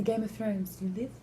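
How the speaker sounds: very soft quoted threat; hushed menace. Acted. Quoting Cersei Lannister's line, hushed menace. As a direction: murmur it as a quiet warning.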